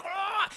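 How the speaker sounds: high strangled cry of pain; pained yell. Retold or imitated. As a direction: cry out as fingers dig in. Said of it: "He's like".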